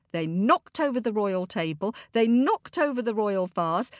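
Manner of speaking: usual speaking voice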